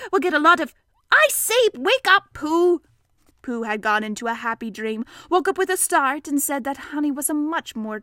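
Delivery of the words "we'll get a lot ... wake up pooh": high, loud, urgent call; calling out to wake someone. A narrator's reading. Piglet rousing Pooh from a happy dream, loud call. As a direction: break off and call sharply.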